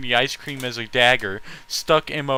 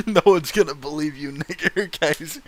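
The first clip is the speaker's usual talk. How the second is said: laughing; 3 semitones higher, 3 dB louder than usual. Real, not acted. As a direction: talk through real laughter. Reader laughing at line.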